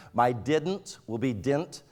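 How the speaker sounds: didn't said as "dint", one clipped syllable, slowly; country drawl turning didn't into dint; lazy Michigan speech. Shown as a demonstration. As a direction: squash didn't into "dint", no middle t. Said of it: "It's just lazy speech".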